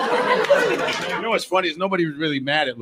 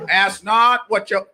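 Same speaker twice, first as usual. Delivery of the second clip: mock Kennedy; 9 semitones higher, 5 dB louder, slower than usual. Parodying Kennedy speech.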